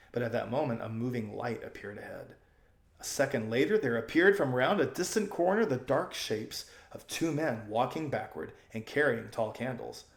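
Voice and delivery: ordinary delivery, nothing marked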